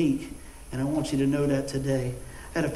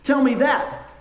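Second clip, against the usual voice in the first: challenging retort; 7 semitones higher, 6 dB louder, slower than usual. Retold or imitated. Doctor challenging him.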